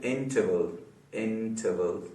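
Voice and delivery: Interval slow and soft, split into its syllables. Shown as a demonstration. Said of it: "तीन syllables वाला word". (Interval को हिस्सों में धीरे-धीरे)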